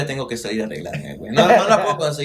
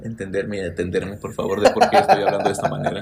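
much lower, softer suave greeting; lower, softer mock seductive hello; deep seductive voice; 7 semitones lower, 3 dB quieter than usual. Shown as a demonstration. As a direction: put on a low smooth flirting voice. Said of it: "Practica tu voz".

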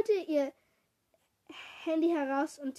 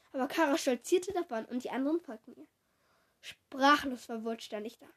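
ordinary delivery

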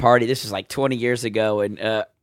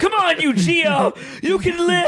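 much higher, slow mock plea to a dying patient; mock frantic doctor; slower than usual. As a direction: ham up a desperate medic reviving someone. Acting out a doctor doing compressions.

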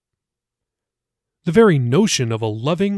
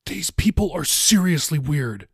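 inner thought; 3 semitones higher than usual. Acted. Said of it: "he thought".

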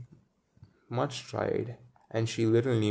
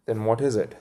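ordinary delivery, nothing marked

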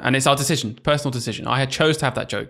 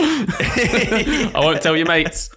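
laughing tease; 6 semitones higher, much slower than usual. Pretend. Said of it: "teasing joke".

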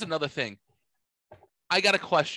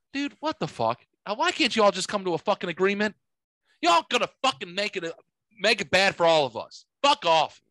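higher-pitched, fed-up outburst; plead, then tell them all off; exasperated rant; 5 semitones higher than usual. Acted. Exasperated rant at both sides.

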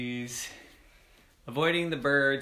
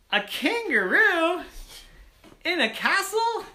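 incredulous surprise; 6 dB louder than usual. Acted. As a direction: squeal the question in astonishment. Children astonished at kangaroo guard.

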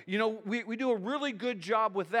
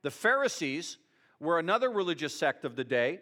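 normal talking voice

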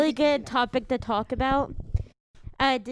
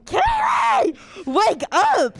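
high loud yell to wake up; mom yelling; 7 semitones higher, 5 dB louder, much slower than usual. Acted. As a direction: shout it like a mom waking her kid. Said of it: "impression of my mom".

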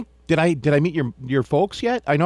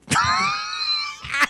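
high shrieking laugh. Real, not acted. Burst of laughter after joke.

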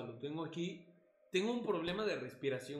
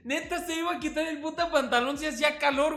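excited teasing; 7 semitones higher, 5 dB louder than usual. Real, not acted. Mocking friend loudly.

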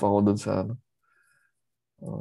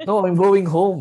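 high, loud retold protest; mock-exasperated, raised-voice correction; emphatic correction; 9 semitones higher, 9 dB louder, slower than usual. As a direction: insist as if misunderstood. Retold mix-up over going home.

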